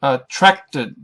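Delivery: Attracted with TRACT punched; stress on middle syllable. Shown as a demonstration. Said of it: "the middle syllable".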